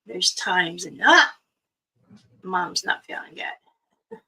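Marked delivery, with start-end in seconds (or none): 2.4-4.2 slow, hushed whisper of pain. Retold or imitated. A mother quietly admitting pain to family, slow whisper. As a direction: breathe it out as if hiding the hurt. Quoting herself hiding her pain.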